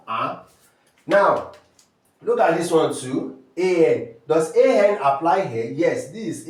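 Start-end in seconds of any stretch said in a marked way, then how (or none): none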